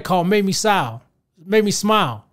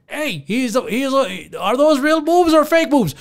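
mock reporter; 5 semitones higher, slower than usual. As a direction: blurt it like a tactless reporter. Imitating the Canadian reporter.

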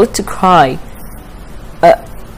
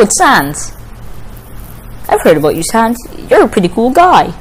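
ordinary talk

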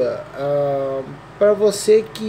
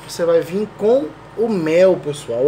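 usual speaking voice

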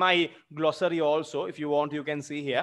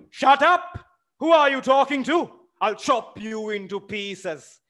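high, loud threat; threatening shout; 7 semitones higher, 5 dB louder than usual. Acted. Smirnov threatening the servant Luca, loud and furious. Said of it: "he threatens Luca".

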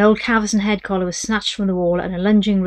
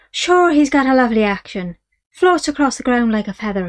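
higher, louder smooth-talking Irish dealer; a wheedling Irish trader; Irish horse dealer; 4 semitones higher, 5 dB louder than usual. Acted. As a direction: sell the horse with easy Irish charm.

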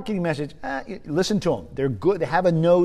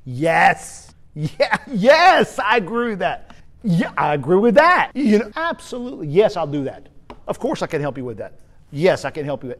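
higher, louder gushing agreement; over-eager yes, yes, absolutely; eager yes-sayer; 3 semitones higher, 6 dB louder than usual. Retold or imitated. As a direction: play the people-pleaser who agrees to everything.

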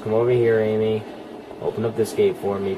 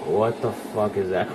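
ordinary talk, nothing marked

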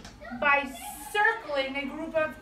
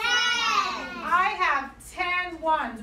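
slow modelling voice; 3 semitones higher, 5 dB louder, much slower than usual. Acted. Teacher models the key phrase.

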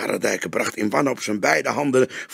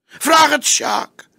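crying plea; 9 dB louder, slower than usual. A narrator's reading. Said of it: "Hij huilde als een kind".

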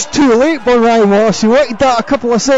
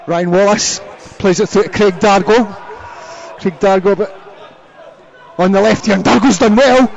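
usual speaking voice

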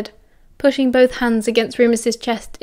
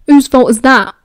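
louder, bitter fierce retort; 8 dB louder than usual. Acted. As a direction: spit it back at him. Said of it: "Remus muttered fiercely".

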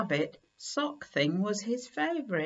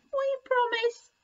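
very high tiny voices; little mice voices. Acted. Mice promising Kipper, tiny high voices. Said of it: "said the mice".

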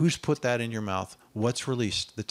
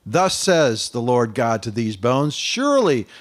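loud, high, fervent proclamation; Isaiah crying woe is me, loud; preaching at full force; 6 semitones higher, 8 dB louder, slower than usual. Acted.

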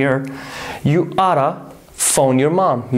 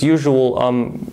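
normal talking voice

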